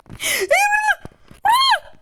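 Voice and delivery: very high, loud, stretched cheer; excited squeal. Real, not acted. Player cheering her own move, excited squeal.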